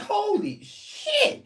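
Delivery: slow, high drawn-out gasp of words; aghast. Retold or imitated. Staring at the bruises, a slow high stunned groan. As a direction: stretch it out, stunned.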